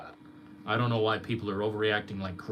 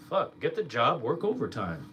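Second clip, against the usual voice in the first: ordinary talk, nothing marked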